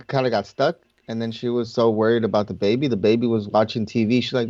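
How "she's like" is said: ordinary talk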